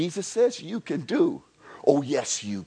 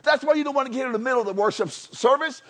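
higher and louder, driving on; fiery preaching; 8 semitones higher, 3 dB louder than usual. Acted. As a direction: preach it out to the congregation. Preaching at full force.

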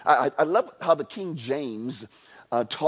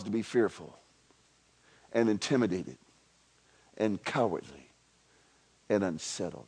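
dropped much lower and softer, a pause before each "and"; slow and soft; 9 semitones lower, 7 dB quieter than usual. Shown as a demonstration. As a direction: let each phrase sink quietly, one at a time. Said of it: "And do not permit yourselves".

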